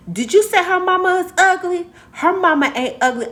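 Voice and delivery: high, loud imitated indignation; mock-indignant, high-pitched defense; confrontational boy. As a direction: challenge them like an outraged kid. Retold boy confronting the insulter.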